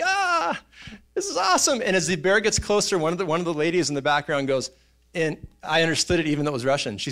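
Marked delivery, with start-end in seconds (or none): 0.0-1.8 very high, gleeful squeal; mock thrilled onlookers. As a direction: imitate delighted spectators in a high voice. Onlookers thrilled as bear approaches.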